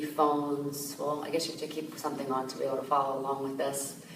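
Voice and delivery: usual speaking voice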